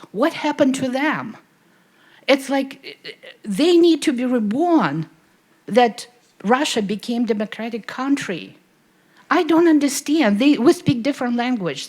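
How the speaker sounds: high, loud and pleading, voice rising; emotional outburst. Real, not acted. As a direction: audience member pours out her pain to Vladimir, loud and upset. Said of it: "I'm a little bit emotional".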